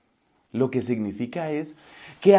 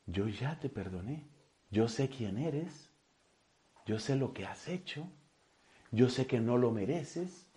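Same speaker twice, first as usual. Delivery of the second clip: tender forgiveness; 7 dB quieter than usual. Acted. God's gentle words of forgiveness. (perdón tierno, suave y lento)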